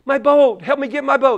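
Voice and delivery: put-on high childish cry for help; little kid pleading. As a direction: beg the big kid like a panicked child. Little kid pleading to older boy.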